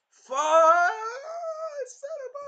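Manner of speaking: very high, loud cry cut off; imitating a hero's anguished yell; furious scream. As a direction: scream as it all falls apart. Hero's everything breaks.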